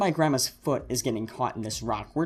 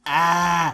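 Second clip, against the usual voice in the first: grandma's exhausted yelp; 5 semitones higher, 7 dB louder than usual. Retold or imitated. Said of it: "grandma's just like".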